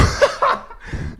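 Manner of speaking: high unvoiced giggle; high breathy giggle. Real, not acted. Streamer breaking into a high airy giggle after the chant.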